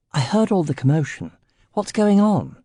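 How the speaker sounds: lightly voiced young woman with a home counties accent; posh home counties English lilt, lightly done. A narrator's reading. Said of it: "she asked in a lovely home counties accent".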